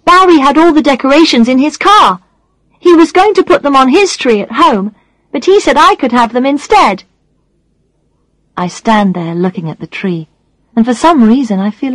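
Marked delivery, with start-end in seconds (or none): 0.0-7.0 put-on high, eager young boy; excited boy voice. A narrator's reading. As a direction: chatter like an excited kid. Child character voice.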